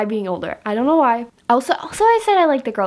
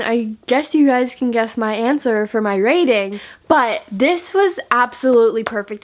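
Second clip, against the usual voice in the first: ordinary talk, nothing marked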